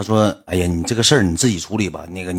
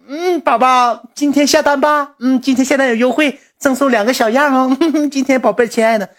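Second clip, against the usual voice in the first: sugary saleswoman; 4 dB louder than usual. Retold or imitated. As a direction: coax the customer sweetly, like a live-stream seller. Assistant's sweet sales pitch.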